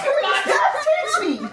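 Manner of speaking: high squeaky hamster cheer; squeaky happy hamster exclaiming; cheery Hamtaro voice. Acted. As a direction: chirp it out like a tiny excited cartoon hamster. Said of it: "Hamtaro exclaimed as he happily".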